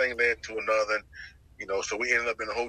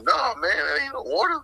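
liquor man; 9 semitones higher, 4 dB louder than usual. Retold or imitated. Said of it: "He's like".

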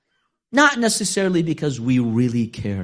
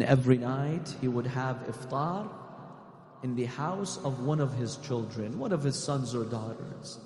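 hushed, softer mourning recital; soft elegy; 2 semitones higher, 9 dB quieter than usual. Acted. Preacher recounting the Imam's last night, hushed and mournful.

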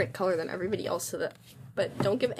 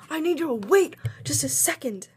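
loud, high call to wait; 6 semitones higher, 6 dB louder than usual. Real, not acted. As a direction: shout for a pause. Friend calls out urgently.

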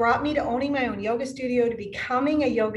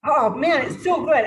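ordinary talk

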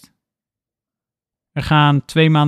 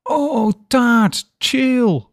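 much higher, delighted cry; excited exclamation; 11 semitones higher, much slower than usual. A narrator's reading. Roommate spotting cake in the kitchen, high excited cry. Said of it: "roept hij".

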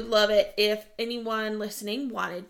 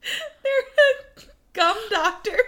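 laughing; 12 semitones higher, 9 dB louder, much slower than usual. Real, not acted. Explaining while laughing.

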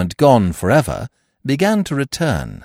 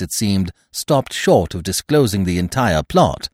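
plain reading voice

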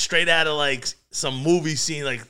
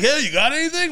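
asking friends; 12 semitones higher, 5 dB louder than usual. Retold or imitated. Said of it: "they're like".

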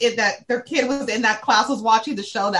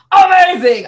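loud shouted "amazing!", much higher; Jeff Garlin impression; 5 semitones higher, 9 dB louder, slower than usual. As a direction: burst out like an excitable sitcom guy. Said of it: "if you need me to do".

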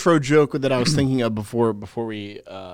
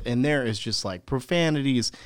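ordinary delivery

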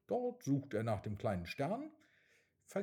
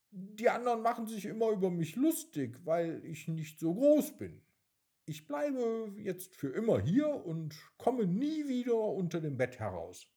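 crying; 9 semitones higher, 5 dB louder than usual. A narrator's reading. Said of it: "Weinend sagt der kleine Stern".

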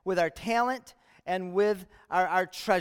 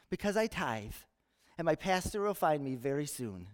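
softer, lower and serene; gentle and calm; 5 semitones lower, 7 dB quieter than usual. Acted. Calm castaway reassuring his friend, gentle and soft. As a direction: deliver the punchline with easy calm. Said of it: "very gently and calmly says".

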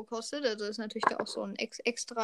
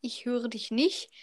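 slow, spelled-out words; to someone hard of hearing; slower than usual. Real, not acted. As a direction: speak slowly so they catch it. Bad connection, spelling it out.

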